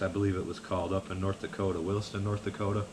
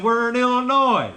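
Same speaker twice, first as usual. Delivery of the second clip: buddy's voice; 14 semitones higher, 9 dB louder than usual. Retold or imitated. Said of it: "he said".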